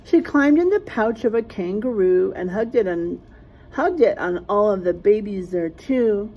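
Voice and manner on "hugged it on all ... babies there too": ordinary delivery, nothing marked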